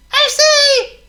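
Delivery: high, loud mouse voice; squeaky mouse. Acted.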